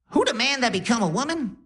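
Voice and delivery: high-pitched comic grandma question; Cat Williams. Retold or imitated. Grandma on her deathbed asks grandson, high Def Jam-style voice. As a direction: imitate grandma in a squeaky Def Jam cadence. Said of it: "she was talking in a crazy accent, like, uh, like Def Jam comedy, like Cat Williams".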